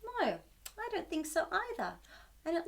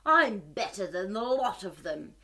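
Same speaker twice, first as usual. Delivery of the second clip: lower, proud monster boast; spiteful pride; 7 semitones lower, 3 dB louder than usual. Acted. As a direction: puff up like a smug monster. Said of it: "he said with spiteful pride".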